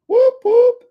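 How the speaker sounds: high, loud double whoop; celebratory whoop. Real, not acted. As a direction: whoop it up for the crowd. Cheering on viewers' answers.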